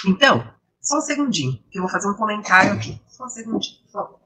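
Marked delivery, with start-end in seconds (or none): 3.1-4.2 softly aside. Real, not acted. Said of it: "que eu não quero perder a minha voz".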